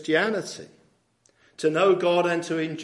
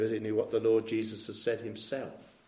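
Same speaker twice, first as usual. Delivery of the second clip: ordinary talk, nothing marked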